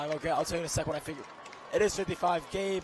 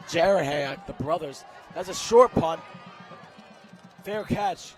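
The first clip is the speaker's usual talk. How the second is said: excited call; 6 dB louder, slower than usual. Real, not acted. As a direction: rise with the play. Live play-by-play rising excitement.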